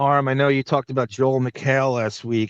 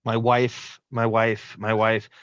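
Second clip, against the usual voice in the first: mocking "my wife". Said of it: "Why can't you say Beth?".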